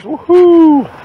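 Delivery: high, gleeful victory whoop; excited whoop. Real, not acted. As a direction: cheer like you just struck gold. Celebrates finding a quarter.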